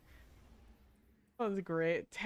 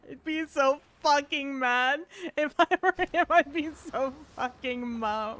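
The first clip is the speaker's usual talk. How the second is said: louder and higher, slowed by laughter; laughing; 5 semitones higher, 5 dB louder than usual. Real, not acted. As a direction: get the words out between laughs. Laughing at another player's misplay.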